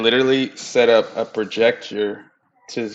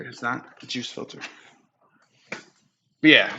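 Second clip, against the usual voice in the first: ordinary delivery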